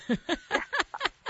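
high burst of laughter; real high-pitched laughing; amused laugh. Real, not acted. Laughs at the doofus quote.

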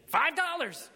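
sudden high, loud call; called-out bid. A narrator's reading. Shabby man bidding from behind, sudden high shout. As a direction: shout a bid across the tent.

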